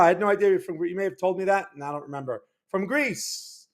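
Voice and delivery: usual speaking voice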